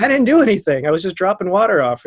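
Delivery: high, loud defensive protest; protesting innocence. Retold or imitated. Bar back defending himself to accusing staff, high defensive protest.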